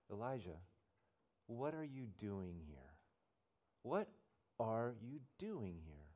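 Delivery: God's soft question to the hiding prophet; gentle whisper. Acted. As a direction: ask it quietly and tenderly. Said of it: "a gentle whisper came".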